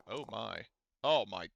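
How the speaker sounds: ordinary delivery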